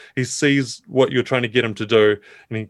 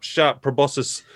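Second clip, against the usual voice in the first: normal talking voice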